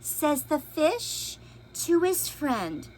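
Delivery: lively storybook read-aloud; expressive picture book reading; reading to children. A narrator's reading. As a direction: read it big for little listeners.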